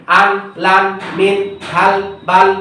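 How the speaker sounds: very slow, one word at a time; slow word-by-word reading; slow reading demo. Shown as a demonstration. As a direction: pronounce each word slowly without spelling. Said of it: "শব্দগুলো পড়বে".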